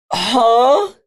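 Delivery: rising, stretched questioning sound; puzzled reaction. Retold or imitated. Viewer recalling reaction to Ben's eviction, puzzled rising query. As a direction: let it climb in mock bafflement.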